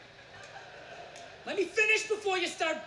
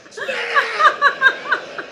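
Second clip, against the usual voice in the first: very slow, much louder and higher; drawn-out yell; 12 semitones higher, 13 dB louder, much slower than usual. Acted. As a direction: stretch the words into a huge yell. Chef yelling at dieter.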